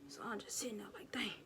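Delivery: fast frightened whisper. Retold or imitated. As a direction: whisper it frozen in bed. Sleep paralysis.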